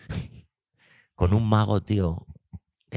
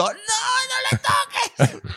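mock panic shout; 3 dB quieter, much slower than usual. Everyone yelling at the stylist.

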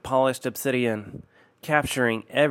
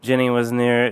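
ordinary delivery, nothing marked

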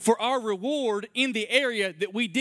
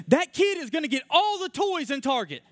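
high, mildly raised mock yell; imitated child's yell; 8 semitones higher than usual. Kid in a cart complaining to grandma, imitated high yell. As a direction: mimic a kid's high-pitched complaint. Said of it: "And he's yelling".

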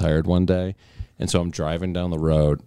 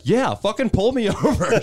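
much higher, slow, breaking into a laugh; defiant dare. Retold or imitated. Driver daring the cop in the story, defiant and laughing. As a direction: dare him, laughing at your own nerve.

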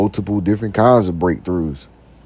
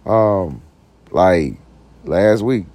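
ordinary talk

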